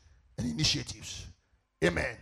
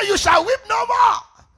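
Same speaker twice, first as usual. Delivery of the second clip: slow, high, loud scripture shout; shouted proclamation; 12 dB louder, slower than usual. Real, not acted. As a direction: proclaim it to the whole church at full voice. Preacher proclaims the verse, then repeats it.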